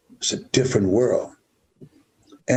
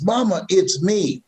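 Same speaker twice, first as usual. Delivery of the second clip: high-pitched, imitated plea; pleading child; 9 semitones higher than usual. Boy facing his mother's crazed look, acted pleading. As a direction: act out a child begging mother to stop.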